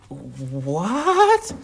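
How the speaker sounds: drawn-out, rising what; baffled what, swooping up; incredulous reaction. Acted. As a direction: stretch the question out in disbelief.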